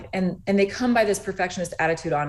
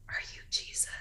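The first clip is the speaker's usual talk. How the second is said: soft whisper, no voice at all; whispered question; 15 dB quieter than usual. Retold or imitated. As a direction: whisper it like a secret to a child. Mother quietly asks daughter at bedside.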